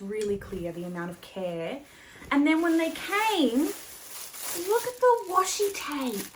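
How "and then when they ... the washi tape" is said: high, loud, excited show-off; real excitement, pitched way up; delighted gushing. Real, not acted. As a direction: gush over it in a raised, thrilled pitch. Showing off the gift.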